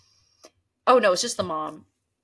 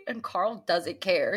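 normal talking voice

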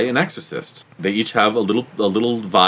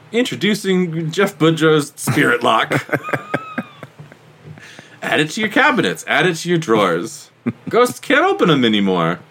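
infomercial; 5 semitones higher than usual. Pretend. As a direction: pitch the product like a TV ad. Mock ad for ghost product.

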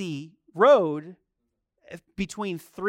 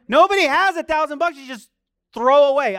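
higher and louder, pounding the point home; preaching at full force; 8 semitones higher, 10 dB louder than usual. Real, not acted. Preacher to congregation, driving home the point, full-force preaching.

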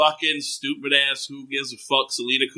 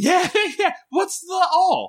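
laughing disbelief; slower than usual. Real, not acted.

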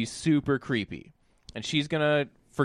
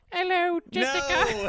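very high, slow little Cockney voice; mock Cockney doll; slower than usual. Creepy doll greeting the babysitter through the door, mock Cockney. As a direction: squeak it out as a tiny Cockney doll. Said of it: "talking with a little, like, Cockney accent".

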